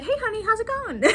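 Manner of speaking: high, quick sweet husband greeting; chirpy husband imitation. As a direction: greet your wife in a playful high voice. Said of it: "And he's just like".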